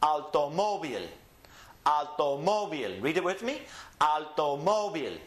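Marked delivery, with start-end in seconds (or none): 0.0-3.0 Automóvil with MÓ raised, then falling on vil; stress on MÓ. Shown as a demonstration. Teacher modelling the accent rule, raised stressed MÓ. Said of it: "raise the tone of your voice on that syllable". 4.0-5.2 Automóvil with MÓ raised, then falling on vil; stress on MÓ. Shown as a demonstration. Teacher modelling the accent rule, raised stressed MÓ. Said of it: "raise the tone of your voice on that syllable".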